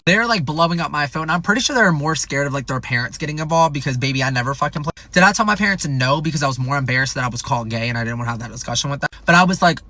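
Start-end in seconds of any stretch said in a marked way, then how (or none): none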